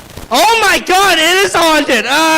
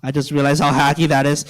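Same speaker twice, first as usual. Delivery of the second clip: ordinary talk, nothing marked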